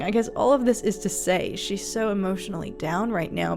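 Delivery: normal talking voice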